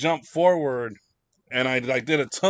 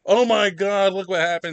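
high, loud cry of alarm; acted emergency outburst; mock panic; 6 semitones higher, 8 dB louder than usual. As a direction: cry out as if badly hurt. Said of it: "bleeding out of the side of my head and go".

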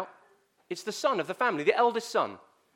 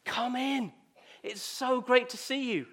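higher, slower glad welcome from the family; family welcoming the orphan girl in, higher and slow; 6 semitones higher, slower than usual. Acted. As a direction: greet her like long-lost kin, drawn out and bright. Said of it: "And what do the family say?".

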